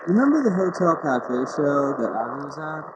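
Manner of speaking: muffled audio, like a hand over the mouth. Real, not acted. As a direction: speak from behind your hand, dampened. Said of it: "your audio kinda got muffled right there".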